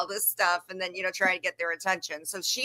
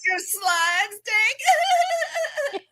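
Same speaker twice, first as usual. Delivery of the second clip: laughing mock voice; 12 semitones higher, 3 dB louder, much slower than usual. Mocking voice with laughs.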